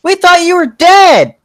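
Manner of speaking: put-on high, loud excited kid voice; squealing child's holler; shouting children. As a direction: shout it high and drawn out like little kids. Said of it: "come running out and shout".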